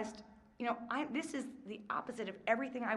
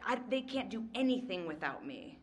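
normal talking voice

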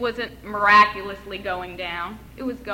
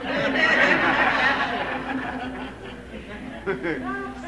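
short warm chuckle at her own joke; laughing at the mainline joke; amused laugh; 3 semitones higher than usual. Real, not acted. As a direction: let a laugh roll out.

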